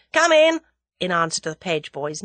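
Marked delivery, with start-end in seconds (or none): 0.1-0.6 loud, high, impatient call; impatient cry. A narrator's reading. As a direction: call out impatiently to whoever knocked. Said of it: "An impatient voice cried".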